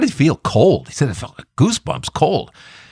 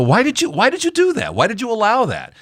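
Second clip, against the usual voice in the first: mock complaint; 11 semitones higher than usual.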